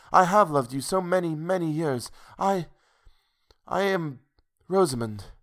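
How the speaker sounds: high-pitched, halting love confession; faltering woman in love; tremulous heroine. A narrator's reading.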